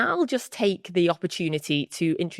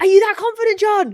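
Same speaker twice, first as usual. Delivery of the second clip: high-pitched, raised-voice disbelief; incredulous; 5 dB louder than usual. Real, not acted. Host challenges guest who says it's already won, incredulous. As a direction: question them in loud astonishment.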